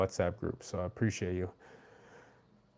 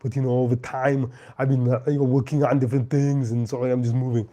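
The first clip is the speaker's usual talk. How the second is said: loud action-star voice with a thick Austrian accent; Arnold Schwarzenegger; 3 semitones higher, 6 dB louder than usual. Shown as a demonstration. Showing viewers his accents, as a loud Austrian-accented movie star. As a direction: boom it out in a heavy Austrian accent. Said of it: "I can do Arnold Schwarzenegger".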